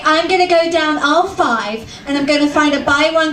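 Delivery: ordinary talk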